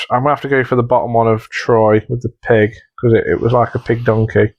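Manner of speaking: ordinary talk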